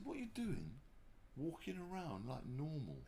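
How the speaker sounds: put-on high, incredulous kid's question; baffled kid. The kid asking why the star walks around, high and baffled. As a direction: squeak it out like a puzzled boy.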